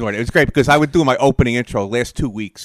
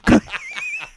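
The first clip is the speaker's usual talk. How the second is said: high squeal of laughter; 6 dB quieter than usual. Real, not acted. Cracking up at his own joke to cohost, squealing laugh. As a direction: let the laugh break up into a squeal.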